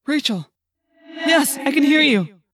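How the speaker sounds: urgent reply. A narrator's reading. Answering friend's distant call.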